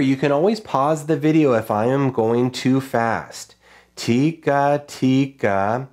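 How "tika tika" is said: "Tika tika" drawn out, one syllable at a time; slow, spaced-out rhythm syllables. Shown as a demonstration. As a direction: stretch each syllable as if writing it. Sounding out while drawing each note.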